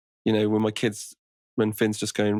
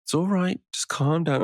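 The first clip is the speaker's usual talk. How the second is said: imitating a calm parent soothing a child; sweetly calm, a bit higher; mock calm soothing; 8 semitones higher than usual. Parent calmly soothing an angry child, mock gentle. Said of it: "Talk calmly".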